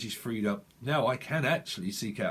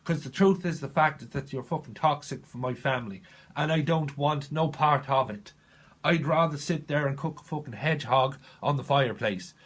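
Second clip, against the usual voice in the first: put-on defiant Irish-accented tirade; hammed-up Irish outburst. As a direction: rant it out in a broad Irish accent. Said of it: "Someone's from Ireland".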